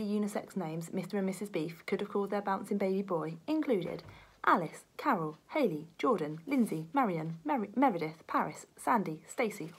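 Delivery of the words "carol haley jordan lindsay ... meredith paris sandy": usual speaking voice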